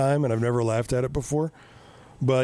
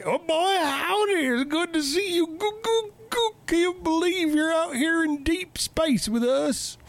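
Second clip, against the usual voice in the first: high folksy Southern cowboy robot; Slim Pickens voice; 14 semitones higher than usual. Retold or imitated. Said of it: "he's voiced by fucking Slim Pickens".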